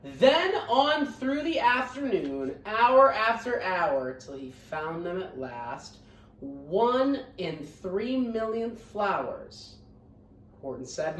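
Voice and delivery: lively read-aloud storytelling; picture book reading voice; story narration. A narrator's reading. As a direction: read it to the class with bounce.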